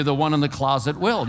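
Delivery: usual speaking voice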